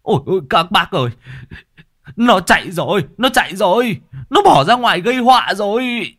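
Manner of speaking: high, loud and fast panicked cry; father yelling to the neighbours; shouting to neighbours. A narrator's reading. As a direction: scream for help. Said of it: "gào thét với hàng xóm".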